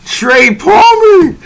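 high, loud scream of joy, name drawn out slow; ecstatic shout. Real, not acted. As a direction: scream his name at the screen. Reacting to a big hit.

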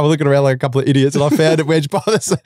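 ordinary talk

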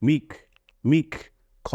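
মিক said with a clear খ at the end; final k sound. Shown as a demonstration. Teacher isolating the final sound for students, slowly. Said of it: "একদম শেষে আছে মিক".

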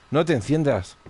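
will it not to light up; tense plea. Real, not acted.

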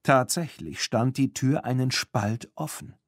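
ordinary delivery, nothing marked

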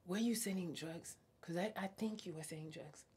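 hushed secretive whisper. Real, not acted. Gossiping about jail on stream.

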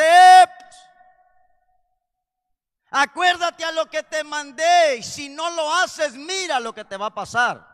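loud, stern call from God; booming divine warning. Retold or imitated. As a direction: thunder it as God's warning. Dios le habla y le dice. (voz de Dios fuerte y severa)

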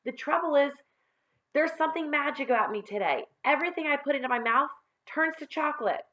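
put-on high-pitched boy pleading innocence; pleading, high schoolboy excuse; protesting. A narrator's reading. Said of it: "John protested".

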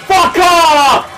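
high, loud, drawn-out yell; real frustrated scream; furious scream. Real, not acted. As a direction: bellow it at the enemy in rage. Screams at attacking enemies.

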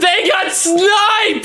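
very high, slow, drawn-out cry of shock; high-pitched slowed shriek of surprise; stunned squeal. Real, not acted. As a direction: squeal it out in disbelief. Sudden kill seen in game.

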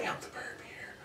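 a ghostly whisper from his conscience; inner voice whisper. Retold or imitated. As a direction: whisper like a voice from inside your spirit. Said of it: "something inside my spirit was like".

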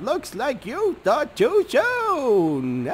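high, slow taunting mate; first mate voice. Acted. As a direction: drag it out smugly. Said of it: "The first mate said".

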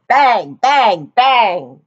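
"Bang, bang, bang" as loud tin noise; can banging. A narrator's reading. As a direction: bang out the cans. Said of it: "hit tin cans just as loudly".

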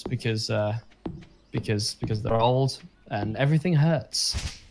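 usual speaking voice

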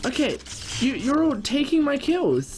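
high, aggrieved protest; indignant complaint. Real, not acted. Complains of stolen kills.

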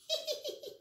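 high-pitched ticklish giggle; tickled little laugh; acted giggle. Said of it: "laughed Floppy".

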